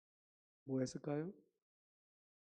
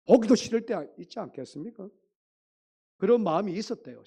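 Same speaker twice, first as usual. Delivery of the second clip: much louder and higher, exasperated; emphatic preaching; 6 semitones higher, 11 dB louder than usual. Real, not acted. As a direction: belt out the frustration to the church. Preacher stresses parents' frustration.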